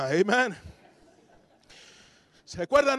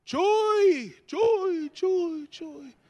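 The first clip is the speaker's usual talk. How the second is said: high sing-out call, fading away; parent calling child; 9 semitones higher, much slower than usual. Retold or imitated. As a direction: call the name repeatedly, letting it fade. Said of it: "O te decía".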